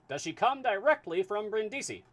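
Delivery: put-on high, loud transatlantic accent asking; booming high transatlantic question; transatlantic voice for Fix. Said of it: "need a voice for Fix".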